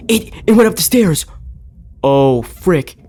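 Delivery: high, loud alarmed cry; scared shout. Real, not acted. Monster went up stairs.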